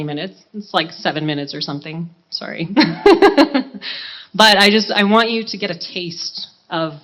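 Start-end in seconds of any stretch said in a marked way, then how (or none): 2.7-4.3 bright, high burst of laughter; real laugh. Real, not acted. Singer apologizing, then laughing high and loud. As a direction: laugh it off, a little embarrassed.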